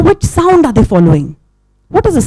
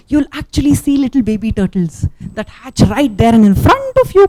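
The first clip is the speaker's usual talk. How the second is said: plain reading voice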